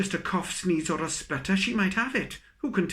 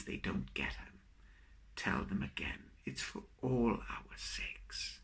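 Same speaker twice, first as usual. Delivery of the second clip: hushed whisper; 5 semitones lower, 9 dB quieter than usual. Acted. Poet whispering a closing reminder to the audience, hushed. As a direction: whisper it, soft and slow.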